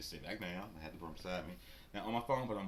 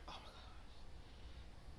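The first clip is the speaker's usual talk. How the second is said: very slow breathless whisper; embarrassed whisper, very slow; whispered aside; 17 dB quieter, much slower than usual. Retold or imitated. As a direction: mutter it under your breath in dismay. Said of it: "I'm like".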